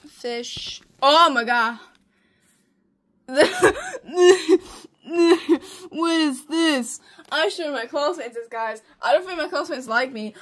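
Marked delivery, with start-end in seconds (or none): 3.3-5.8 loud, high cackle; laughing. Real, not acted. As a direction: burst out laughing. Laughing at own old drawings.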